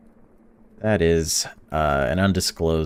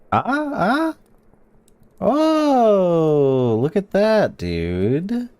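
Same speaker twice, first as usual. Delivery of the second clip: excited exclamation; 13 semitones higher, 4 dB louder, much slower than usual. Real, not acted. Reacting to card draw.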